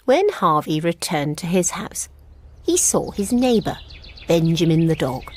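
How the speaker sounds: usual speaking voice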